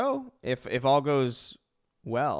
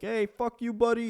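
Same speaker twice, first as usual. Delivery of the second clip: imitated heckler yell; 10 semitones higher than usual. Passenger screaming at you from a passing car, mock yell. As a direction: pitch it up as a held-back drive-by holler. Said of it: "some dude in the passenger car is screaming".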